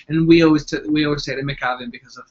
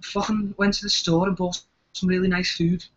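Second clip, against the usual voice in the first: higher-pitched Scouse accent; 4 semitones higher than usual. Shown as a demonstration. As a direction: do a put-on Liverpool accent. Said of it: "do your Scouse accent".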